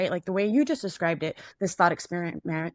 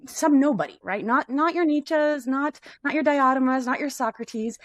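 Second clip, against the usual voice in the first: higher and louder, each "not" hammered; emphatic rising passion; 7 semitones higher, 3 dB louder than usual. Real, not acted. Arguing for the forgotten sufferer, emphatically raised voice. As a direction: build it up as you champion the nobody.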